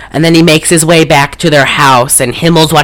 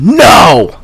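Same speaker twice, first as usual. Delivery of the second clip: sharp retold shout of no, only a touch louder and higher; 4 semitones higher, 4 dB louder than usual. Podcaster reliving yelling at the movie, mock shout. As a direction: bark a mock protest at the screen. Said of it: "I shouted".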